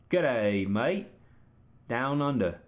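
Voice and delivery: badly faked Australian drawn-out greeting; terrible down under voice. Streamer mocking his own attempt, bad Australian accent. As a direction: try a hammy Aussie accent. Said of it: "My down under voice is terrible".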